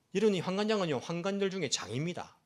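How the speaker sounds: ordinary talk, nothing marked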